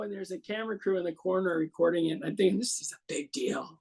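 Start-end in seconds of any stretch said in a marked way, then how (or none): 2.6-3.8 whispered to himself, much softer; hushed inner thought. Retold or imitated. Thinking to himself before the show, in a whisper. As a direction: murmur it as a private thought.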